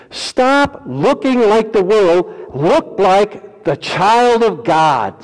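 slow fervent exhortation; emphatic preaching. Acted. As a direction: stretch every word from the pulpit.

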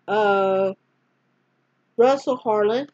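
plain reading voice

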